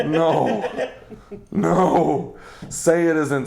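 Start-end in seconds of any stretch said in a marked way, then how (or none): 0.1-0.9 hysterical laugh. Acted. Hysterical reaction to the corpse.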